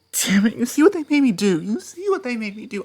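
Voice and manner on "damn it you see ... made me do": angry outburst. Acted. Outburst after striking partner.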